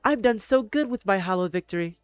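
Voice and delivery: high-pitched mocking gloat. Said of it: "people feel so happy with their hollow victories".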